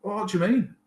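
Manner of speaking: high, stunned question; can't believe what he's hearing; shocked disbelief. Retold or imitated. To the surgeon, a high shocked question.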